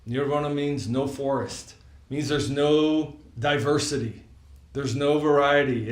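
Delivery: normal talking voice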